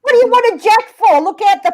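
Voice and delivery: high, loud retold exasperation; exasperated. Retold exasperation at misheard request.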